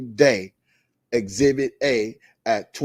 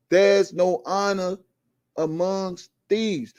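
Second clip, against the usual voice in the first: very slow, higher, each word apart; slower, spaced-out proverb; 6 semitones higher, slower than usual. Real, not acted. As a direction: let every word land slowly. Slower second take.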